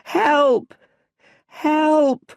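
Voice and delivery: high, loud terrified cries; curled up, screaming for help; desperate shout. Acted. Young Bruce attacked by bats, screaming high and loud. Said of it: "and shouts".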